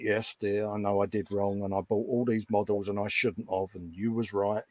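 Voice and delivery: henpecked husband mumbling sorry to his wife; meek, mumbling apology. Retold or imitated. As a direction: talk to your feet, meek and sorry.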